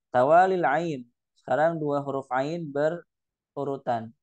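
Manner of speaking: ordinary delivery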